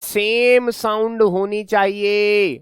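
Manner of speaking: slow, with सेम stressed; answering his own question, slow and stressed; stressing same sound. Shown as a demonstration. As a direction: lean on सेम slowly. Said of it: "क्या होना चाहिए इसमें?".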